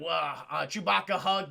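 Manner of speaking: usual speaking voice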